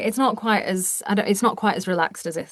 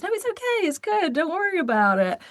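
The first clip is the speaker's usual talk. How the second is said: high, breezy, bright-toned no worries; imitating American reassurance; 11 semitones higher than usual. American brushing off an apology, high breezy imitation. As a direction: chirp it out like an easygoing American. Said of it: "the fine is".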